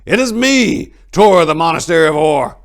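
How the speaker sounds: high, loud call across the forest; monk shouting to stop the archers. A narrator's reading. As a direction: bellow it so the hidden archers hear. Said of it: "he cried out".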